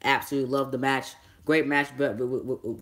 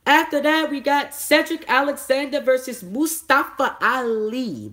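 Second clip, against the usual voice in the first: excited announcing; 12 semitones higher, 5 dB louder than usual. Real, not acted. Hyped announcing the next match.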